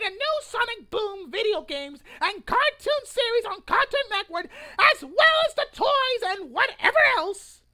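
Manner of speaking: loud, high declaration of a grievance; a formal statement delivered to a crowd; proclamation read. Acted. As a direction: read it like a public manifesto. Reads his protest manifesto aloud.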